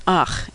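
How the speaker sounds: "ach" ending in a throaty rasp, as in German; throat-clearing ending. Shown as a demonstration. Teacher giving a German example, "ach" with throat-clearing ending. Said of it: "a throat-clearing noise".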